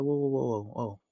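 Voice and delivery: soft, calming whoa; low-key, hushed objection. Shown as a demonstration. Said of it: "you wanna keep a calm tone".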